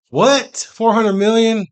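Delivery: high-pitched disbelieving question; incredulous. Real, not acted. Host balking at four hundred million dollars, incredulous. Reacting to huge fundraising figure.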